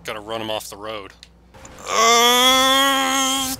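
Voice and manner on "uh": high, loud, drawn-out "uhhh"; sustained high wail of effort; long straining groan. Real, not acted. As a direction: hold a rising groan as you strain. Straining to ram a car.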